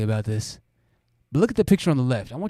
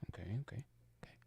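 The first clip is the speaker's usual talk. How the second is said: whispered; 5 semitones lower, 24 dB quieter, much faster than usual. Real, not acted. Muttering to self.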